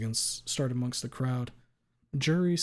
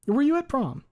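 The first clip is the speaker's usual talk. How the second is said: higher, louder lawyer question; 8 dB louder than usual. Acted. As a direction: ask it like a sharp attorney.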